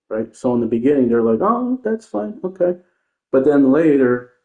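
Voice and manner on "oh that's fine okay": much higher, sing-song mock agreement; mocking fake calm. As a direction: parrot them in a prissy high voice. Mocking opponents' early reaction.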